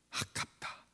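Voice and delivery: very soft acted lament; whispered regret. Acts disciples regretting the waste. (아까워하며 속삭임)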